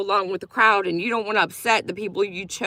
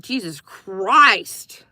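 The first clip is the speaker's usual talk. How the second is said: drawn-out disbelief; 3 semitones lower, much slower than usual. Retold or imitated. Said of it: "I'm like".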